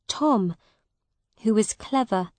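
ordinary delivery, nothing marked

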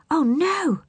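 high dismayed cry. A narrator's reading. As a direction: gasp it out in alarm. Dropped the chest.

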